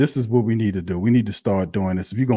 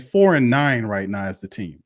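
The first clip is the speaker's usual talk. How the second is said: ordinary delivery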